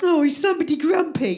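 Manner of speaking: high, babying mock sympathy; patronising sing-song question; mocking coo. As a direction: tease as if talking to a sulky toddler. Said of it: "you guys would just be like".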